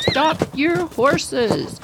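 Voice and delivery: high, slow, drawn-out call to the riders; mock announcer call. Announcer halting the horse show riders, slow high call.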